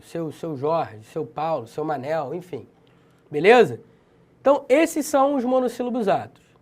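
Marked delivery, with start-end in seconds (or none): 0.0-2.7 trailing off. Shown as a demonstration. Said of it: "trailing list".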